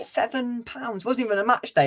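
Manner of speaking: outraged disbelief. Real, not acted. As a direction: drag it out high in outrage. Outraged at hot dog price.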